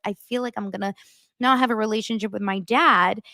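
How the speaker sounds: ordinary talk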